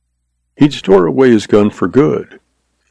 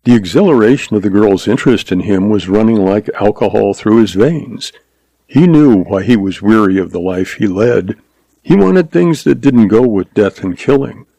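ordinary delivery, nothing marked

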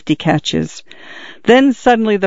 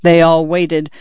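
usual speaking voice

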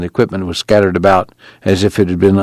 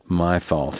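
soft, dejected self-blame; quietly crushed with guilt; miserable; 9 dB quieter than usual. A narrator's reading. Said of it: "he said miserably".

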